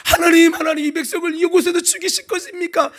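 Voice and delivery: high pleading cry to God. Acted. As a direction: plead upward, desperate. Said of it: "모세가 하나님께 부르짖기 시작합니다".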